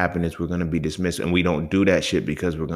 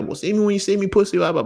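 much higher, retold taunting threat; mock threat; 4 dB louder, faster than usual. In the argument with his homie, a higher, louder taunt. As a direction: throw out the challenge high and loud.

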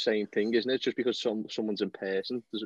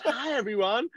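higher, lightly sweet TV greeting; posh presenter; 11 semitones higher than usual. Retold or imitated. Imitating Kelly Osbourne show greeting, lightly posh. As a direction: chirp a polite show opener.

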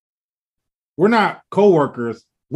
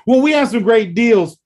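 higher, louder, slow salesy pitch; mock telemarketer; 7 semitones higher, 5 dB louder than usual. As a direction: ham up the pushy seller's slow sales line. Telemarketer keeps pitching after refusal.

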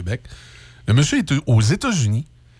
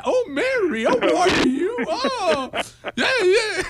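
much higher, affected cocktail-party English; mock posh English; slower than usual. Host pretends to chat at a fancy cocktail, affected English, much higher. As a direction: gush like a snob with a raised pinky. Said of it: "pratiquer notre anglais".

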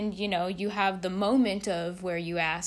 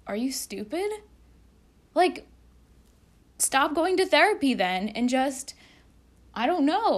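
higher, louder, incredulous scold; exasperated scolding; 5 semitones higher, 5 dB louder than usual. Real, not acted. Vlogger scolding someone wasting their therapist's time, exasperated. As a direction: scold them like it's obvious.

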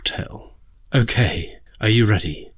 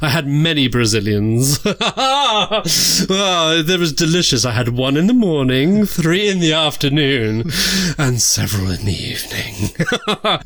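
cheeky, giggly camp diva; campy drag queen; 3 semitones higher than usual. Acted. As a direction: tell it like a naughty drag diva. Host persona bawdy tale.

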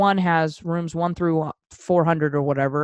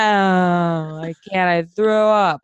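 mock drunken protest, louder at the end; whiny drunk; 5 dB louder, much slower than usual. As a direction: imitate a sick drunk refusing. Acts her drunk self refusing.

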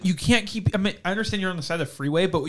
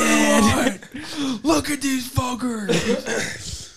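angry friend; 6 semitones higher, much slower than usual. Retold or imitated. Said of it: "And your friend's like".

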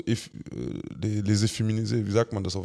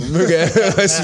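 laughing; 5 semitones higher, 6 dB louder than usual. Real, not acted. Lacht beim Segen.

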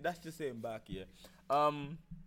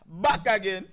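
hyped greeting; 4 semitones higher, 11 dB louder, slower than usual. Acted. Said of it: "show greeting".

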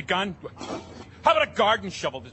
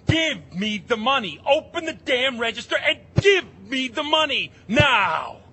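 loud furious demand; angry shout; 4 semitones higher, 5 dB louder than usual. Acted. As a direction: bark it at the clerk.

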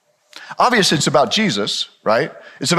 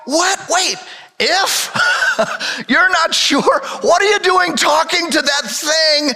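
can't believe what he's hearing; preacher mock-scolding Peter in disbelief; incredulous; 11 semitones higher, slower than usual. Real, not acted.

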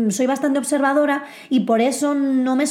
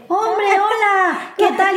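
mock greeting; 6 semitones higher, much slower than usual.

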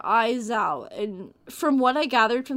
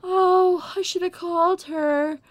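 imitated sobbing; 6 semitones higher, 3 dB louder than usual. Old woman regretting not calling friend, imitated sobbing. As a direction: mimic her crying regret. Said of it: "sobbing her eyes out".

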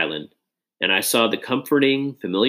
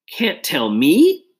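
slow, rising, higher disbelief; exclaimed; 6 semitones higher, 4 dB louder, much slower than usual. A narrator's reading. Best friend shut out of a secret, slow disbelieving exclaim. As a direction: stretch it out in hurt disbelief. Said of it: "Josh exclaimed".

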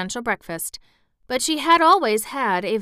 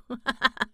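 a quiet chuckle at the story; brief amused chuckle; soft real laugh; 3 semitones higher, 3 dB quieter than usual. Real, not acted. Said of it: "Oh, just wild kids out eating grass".

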